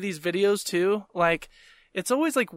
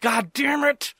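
seethe, then let the curse burst out higher; mounting anger; 5 semitones higher, slower than usual. Retold or imitated. Diner cursing Subway with every bite, mounting anger. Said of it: "getting more and more angry".

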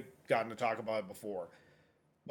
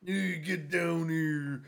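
wrestling callout; 4 semitones higher, much slower than usual. Pretend.

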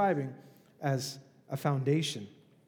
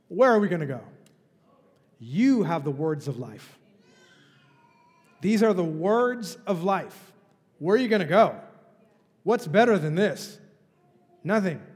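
preaching loud; 2 semitones higher, 7 dB louder than usual. Acted. Preacher rising to full force.

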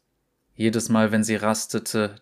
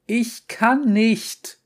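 very high, loud, slow scream; desperate scream; 13 semitones higher, 5 dB louder, much slower than usual. A narrator's reading. Girl screams her refusal at the inner voice, very high and slow. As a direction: break into a despairing scream, much higher. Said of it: "schrie sie".

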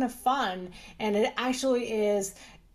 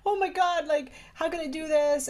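higher-pitched, mildly panicked plea; retold panic; 5 semitones higher than usual. Doctor reliving a scheduling mistake, panicky. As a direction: replay a moment of panic. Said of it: "I got panicky".

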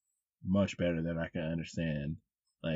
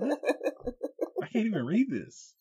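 much higher, laughing mock bafflement; high laughing what, then can't even read this; laughing confusion; 13 semitones higher, slower than usual. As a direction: play it stunned and amused. Reacting to reading patois writing.

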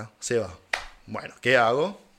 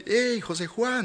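calling out; 9 semitones higher, slower than usual. Retold or imitated. Greeting friend from far away.

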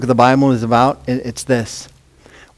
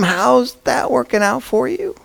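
sarcastic; 9 semitones higher, 5 dB quieter than usual. Acted. Mocking question to UN.

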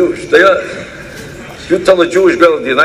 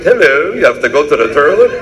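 stiff, overly correct mock English accent; mock proper English; 3 semitones higher than usual. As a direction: play a would-be refined English speaker with a mock accent. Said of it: "They try to talk English perfect".

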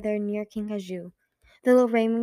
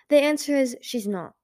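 mildly sharp, pitched-up retort; a touch of sharpness, a bit louder; 5 semitones higher, 5 dB louder than usual. A narrator's reading. Peril answering Sunny's question about killing, sharply. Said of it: "Peril said sharply".

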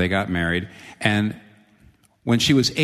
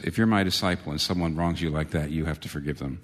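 hushed gravity; 6 semitones lower, 6 dB quieter than usual. Real, not acted. Sobering hush on hard teaching.

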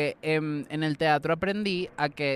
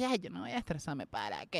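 soft, unbothered shrug of a voice; relaxed, softened self-quote; laid-back; 9 dB quieter than usual. Retold or imitated. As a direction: let it go gently, very quietly. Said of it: "más suavecitas".

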